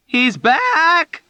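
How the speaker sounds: shrill high scream of panic. A narrator's reading. Dunbar screaming to the ward in terror. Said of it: "Dunbar screamed".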